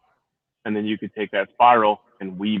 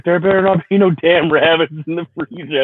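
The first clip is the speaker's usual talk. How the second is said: imitating wife; 8 semitones higher, 5 dB louder than usual. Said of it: "She's like".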